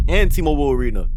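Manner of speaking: loud, high call-out; loud mock threat. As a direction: belt it out as the next target to sue. Pretend victim naming who he sues.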